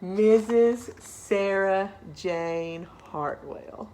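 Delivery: slow, drawn-out formal reveal; grand announcement. Acted. Principal introducing Sarah the new teacher, slow. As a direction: savor the big reveal.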